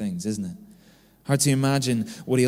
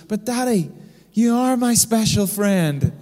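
put-on higher, slowed little-girl plea; imitated pleading daughter; 9 semitones higher, 5 dB louder, slower than usual. Daughter insisting dad is her special friend, imitated pleading. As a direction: imitate a child pleading with Daddy.

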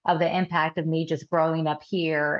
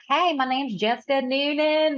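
mock Southern US accent, pitched up; 7 semitones higher than usual. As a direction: introduce yourself like a Southern girl. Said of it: "such a Southern daughter".

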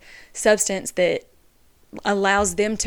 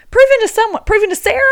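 much higher and louder, exasperated peak; near shout; 13 semitones higher, 11 dB louder, much faster than usual. Real, not acted. Calling out Sarah by name to viewers, near shouting. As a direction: let it burst out at full volume.

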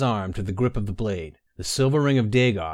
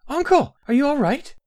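much higher, louder anxious call; worried young woman; 6 dB louder than usual. Acted. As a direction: rush to her uncle, alarmed. Baynara rushes to uncle.

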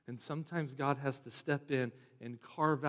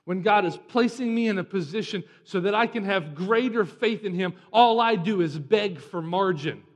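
loud, raised pulpit delivery; forceful preaching; 6 semitones higher, 12 dB louder than usual. Real, not acted. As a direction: confess it emphatically to the congregation.

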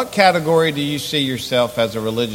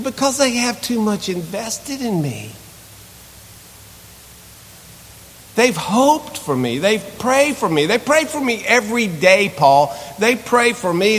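pleading Timothy; 4 semitones higher than usual. Acted. Voicing Timothy pleading with Paul.

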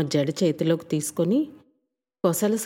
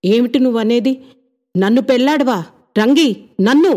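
shocked, loud and higher; upset outburst; 4 semitones higher, 9 dB louder than usual. Acted. The young man crying out at Rangi's refusal. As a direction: blurt it out, stung and indignant. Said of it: "అతను భరించలేకపోయాడు".

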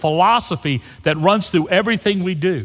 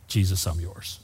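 soft confession; 10 semitones lower, 9 dB quieter than usual. Pretend. Voicing a personal confession.

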